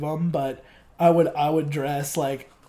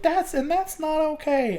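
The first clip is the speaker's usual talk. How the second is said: imitated kid in a high falsetto; high-pitched kid voice; 12 semitones higher than usual. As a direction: repeat it in the kid's squeaky voice. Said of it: "he was just like".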